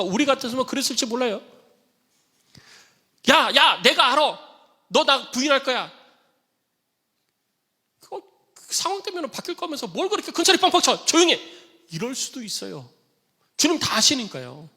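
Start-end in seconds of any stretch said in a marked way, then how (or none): 3.3-11.4 high, loud harsh telling-off; scolding rebuke. Acted. An imagined Jesus scolding boastful Peter, high and loud. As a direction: scold him sharply like an annoyed teacher.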